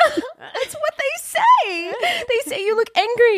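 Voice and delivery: high, slow, whiny retelling; hammed-up high whine of self-pity; mock high whine. Telling the director, in a mock high whine. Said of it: "I was like".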